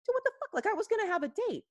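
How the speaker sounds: high-pitched mock exasperation; fed-up mother re-enactment. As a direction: act out a mom finally complaining. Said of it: "she has to have one of her first legit moments".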